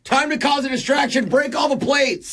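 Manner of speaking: high-pitched comic battle cry; mock urgent call. Co-host acting out a distraction for the caller, mock urgent. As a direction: call it out high like a pretend panic plan.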